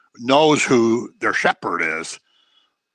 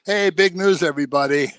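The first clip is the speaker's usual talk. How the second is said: higher-pitched mock call to a crowd; mock announcement; 4 semitones higher than usual. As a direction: announce it like a herald, lightly hammed up. Imagined angel announcing to crowd.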